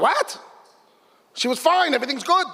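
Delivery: jolted by bad news, voice jumping up; shocked disbelief. Brother hears his sister may die, shocked and high.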